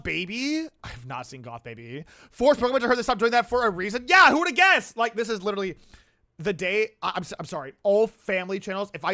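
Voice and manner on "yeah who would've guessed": loud, high, exasperated jab; sarcastic outburst. Real, not acted. Streamer mocks an unsurprising reason, sarcastic yell. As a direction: yell it as if it were obvious.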